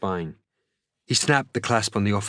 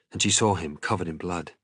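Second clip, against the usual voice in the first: ordinary delivery, nothing marked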